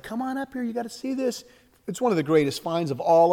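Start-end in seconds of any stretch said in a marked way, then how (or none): 0.0-1.4 high, softer eager call; hushed excitement. Retold or imitated. Shepherd boy calling his dad to the cave, hushed excited. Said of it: "he's like".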